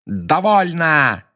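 slow, high, forceful shout; angry shout. A narrator's reading. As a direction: stop him with a roar. Snape breaks the spell.

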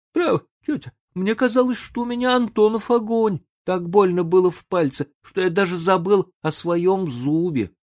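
high, whiny excuses; sheepish boy. A narrator's reading.

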